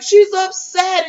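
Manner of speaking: high, slow, mocking drawl; mock her sarcastically; hammed-up sneer; dismissive grandmother. Mean grandmother quoted.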